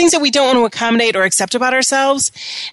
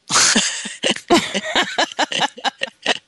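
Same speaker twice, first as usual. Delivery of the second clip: breathy burst of laughter; long chuckle after the punchline; laughing; 3 semitones higher, 4 dB quieter than usual. Real, not acted.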